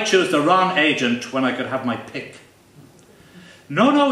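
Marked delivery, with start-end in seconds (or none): 3.7-4.1 a high, loud hurried no; alarmed protest. A narrator's reading. Agent panics at losing a prestigious client, high alarmed no. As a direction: jump in to stop him leaving.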